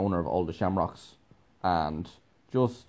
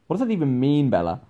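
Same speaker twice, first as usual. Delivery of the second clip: higher, louder exasperated question; baffled protest; 5 semitones higher, 5 dB louder than usual. Retold or imitated. Asking Bella about the song she read into, exasperated. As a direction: play up the incredulity.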